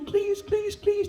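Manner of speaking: very high frantic plea; high-pitched terrified begging; desperate begging. Acted. Narrator begging the gunman.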